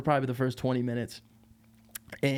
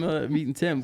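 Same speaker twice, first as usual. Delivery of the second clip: normal talking voice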